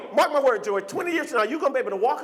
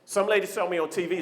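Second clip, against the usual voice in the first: ordinary talk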